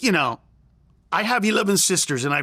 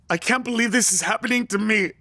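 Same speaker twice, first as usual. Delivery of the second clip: ordinary delivery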